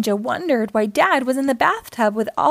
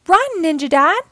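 high, loud warning call; urgent shout; 5 semitones higher, 5 dB louder than usual. A narrator's reading. Naughty Ninja warning Dad about the bubbles, urgent shout. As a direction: shout a warning to get him moving. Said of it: "shouted Naughty Ninja".

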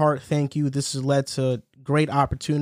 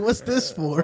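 higher, puzzled mock voice; clueless friend; 5 semitones higher than usual. Retelling Riv's question, mock puzzled. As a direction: act dumbfounded. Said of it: "He's like".

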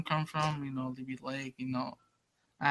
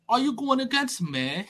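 higher, louder mock British accent; 5 semitones higher, 6 dB louder than usual. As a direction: imitate an indignant British guy. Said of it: "some British guy".

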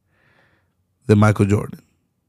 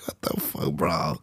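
"What the fuck, bro" in an unvoiced, much softer hush; whispered disbelief; 3 semitones higher, 14 dB quieter than usual. Reacting to a friend's podium picture, whispered disbelief. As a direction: hiss it under your breath, stunned.

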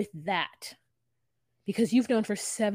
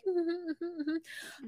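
sceptical mm-hmm; 8 semitones higher, 9 dB quieter, slower than usual. Retold or imitated. Wife's sceptical reply.

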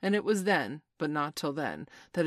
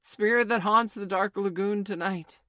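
loud, higher summons; raised voice; 5 semitones higher, 9 dB louder than usual. A narrator's reading. Hook calling to the unseen spirit, voice raised. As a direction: call out across the water to a spirit. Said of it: "Hook raised his voice".

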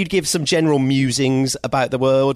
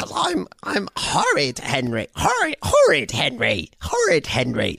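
put-on witchy kid voice; 3 semitones higher than usual. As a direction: growl weakly like a witchy brat. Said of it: "sounds a little bit witchy".

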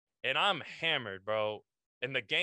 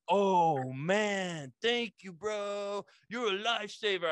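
high, gushing gratitude; overjoyed drunk thanking a stranger; excited thanks; 6 semitones higher, slower than usual. Retold or imitated.

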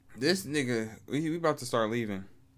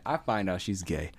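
usual speaking voice

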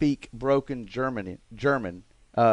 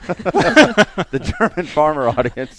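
laughing; 3 semitones higher, 9 dB louder, much slower than usual. Real, not acted. Joking about losing farmer audience.

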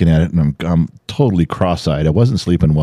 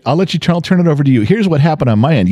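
ordinary talk

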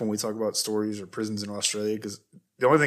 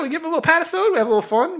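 much higher, bouncy; excited; faster than usual. Real, not acted. Excitedly pitching the fun patisode to cohost. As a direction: sell the fun episode.

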